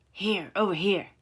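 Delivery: loud shout of a hunter; men yelling to the others in the dark; hollering. A narrator's reading. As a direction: call out to the chase.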